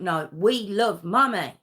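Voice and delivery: put-on boy teasing his father; playful child. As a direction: answer back like it's a game. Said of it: "thought it was some sort of game".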